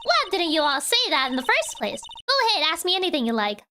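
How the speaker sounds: put-on Southern accent. Photographer excited about the murder, in a Southern accent. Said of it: "see pull it off correctly".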